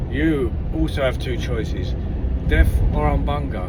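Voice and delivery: a hint of a booming chief. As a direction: lay out the choices like a solemn chief. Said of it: "Chief says to him".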